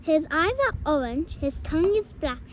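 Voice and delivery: loud, bold little mouse; little mouse boldly turning down the fox, loud; brave mouse voice. Acted. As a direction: play the plucky mouse, proud and sure. Mouse describes Gruffalo.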